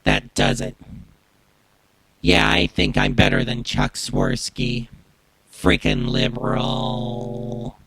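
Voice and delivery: deep processed robotic voice; Max Headroom hijacker voice. Shown as a demonstration. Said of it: "recreate the Max Headroom hijacker voice".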